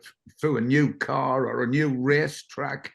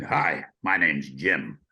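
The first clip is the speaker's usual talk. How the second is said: imitating Jim; 10 semitones lower, slower than usual. Voicing Jim in changing room.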